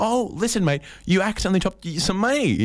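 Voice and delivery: high, chirpy helpful stranger voice; mock friendly stranger. Acted helpful stranger quote.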